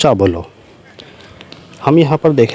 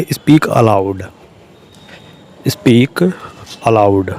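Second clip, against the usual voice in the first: slow dictation; 3 semitones lower, much slower than usual. Shown as a demonstration. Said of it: "teacher repeats phrase for learners".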